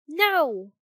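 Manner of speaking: loud, high, hasty reply; quick denial. A narrator's reading. Pinocchio lying to fairy about coins, quick and loud. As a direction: blurt the denial out. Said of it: "said Pinocchio quickly".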